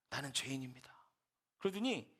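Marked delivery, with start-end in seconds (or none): 0.1-0.9 quiet, lower, tearful confession; retold sobbing prayer. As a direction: softly confess as if starting to weep. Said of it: "막 흐느껴 울더라는 거예요".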